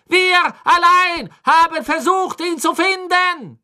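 hoch und laut, trotzig triumphierend; fanatische Hexe ruft. A narrator's reading. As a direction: schleudere Crouch deine Treue entgegen. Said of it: "sah zu Crouch auf und rief". (laut und hoch, fanatisch trotzig)

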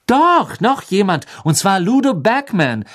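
high, emphatic interjection; insistent correction. Acted.

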